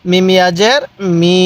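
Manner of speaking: "ইয়াজের" rising, then "মি" held level; measured chant with a long "মি"; drawn-out vowel. Shown as a demonstration. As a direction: spell it and stretch "মি". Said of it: "পড়ুন আমার সাথে".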